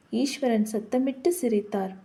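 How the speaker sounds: usual speaking voice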